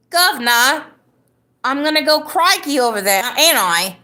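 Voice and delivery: high, hammy British accent. Shown as a demonstration. Drunk streamer trying British again, in a hammy accent. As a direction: pitch it up into cartoon British. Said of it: "I can do a good British accent".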